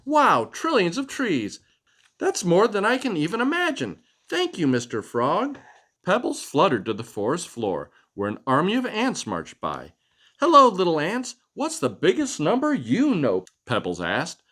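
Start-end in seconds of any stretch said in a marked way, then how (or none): 0.0-5.6 high, eager little butterfly; bright butterfly voice. Acted. Butterfly thanks frog. 10.4-13.4 high, eager little butterfly; bright butterfly voice. Acted. Butterfly asks ants.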